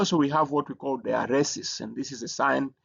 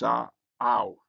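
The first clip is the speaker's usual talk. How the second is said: usual speaking voice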